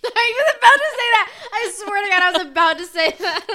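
loud laughing agreement. Real, not acted. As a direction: laugh while insisting.

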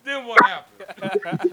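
high, slow, drawn-out question; dramatic prompt. Pretend. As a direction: drag it out like a storyteller. Mock storyteller prompt.

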